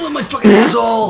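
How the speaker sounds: high, hammed-up cry of pain; mock pained yell. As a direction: yell it out high in pretend agony. Acted victim crying out in skit.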